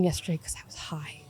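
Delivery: hushed, breathy confession, unvoiced; whispered confession. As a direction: confess it in a whisper, leaning close. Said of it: "I'm like".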